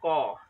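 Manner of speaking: क said alone, short and loud like a teacher's model; sounding out a letter. Shown as a demonstration. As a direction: model the sound क crisply for the class. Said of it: "कमल में पहला अक्षर हमारे होठों से क्या निकला?".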